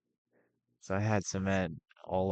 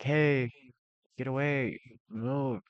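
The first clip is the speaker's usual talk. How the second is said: higher-pitched mock shout; retold scream; 6 semitones higher, 4 dB louder, slower than usual. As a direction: imitate calling someone out of the way. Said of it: "So, I start screaming".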